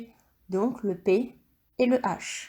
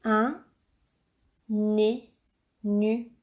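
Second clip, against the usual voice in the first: slow reading aloud; much slower than usual. Shown as a demonstration. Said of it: "à toi de lire le mot".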